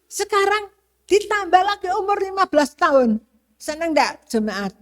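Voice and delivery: ordinary talk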